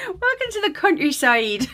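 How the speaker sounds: high mock West Country accent through giggles; hammed-up West Country, giggly; bad West Country accent. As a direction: put on a terrible West Country accent, barely holding back laughter. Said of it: "That's the worst accent ever".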